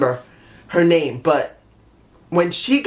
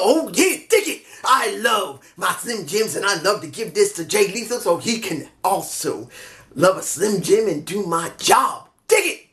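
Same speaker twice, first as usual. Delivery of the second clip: Macho Man impression. Fan imitating the Macho Man promo for viewers, shouting. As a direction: ham it up like a wrestling promo, yelling. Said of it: "I hope that sounded right".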